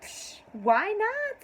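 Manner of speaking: high, drawn-out why-not; carefree shrug. Acted. Woman playing out spending her new wealth, breezy. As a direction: toss it off high with a scoffing psh.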